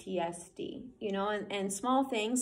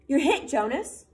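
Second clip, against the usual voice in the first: louder, higher playful call across the field; 6 semitones higher, 8 dB louder than usual. Acted. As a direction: call out from behind a tree. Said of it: "Asher called from behind a tree".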